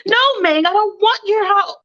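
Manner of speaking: high, fussy mother voice; mock mother voice; mom voice. Mom character voiced in story.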